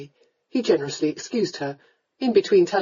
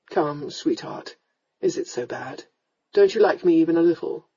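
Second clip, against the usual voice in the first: gentle coaxing; 2 semitones lower than usual. Acted. Lord kneels before crying sweetheart, gently coaxing.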